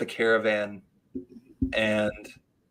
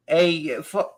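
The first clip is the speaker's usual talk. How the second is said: imitated Boston accent, pitched up; Boston comic's accent; 7 semitones higher, slower than usual. Mimicking the Bostonian opening his set on stage, put-on Boston accent. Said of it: "He does that super, uh, like, talking".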